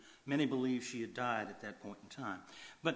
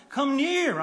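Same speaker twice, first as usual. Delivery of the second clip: much higher, louder call; 13 semitones higher, 10 dB louder than usual. Acted. Joseph calling his brothers to come closer, high and loud. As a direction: beckon them in with a full cry.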